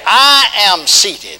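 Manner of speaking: loud, high, very slow call for the crowd to repeat; preaching call. Acted. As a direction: chant it out line by line. Call and response with congregation.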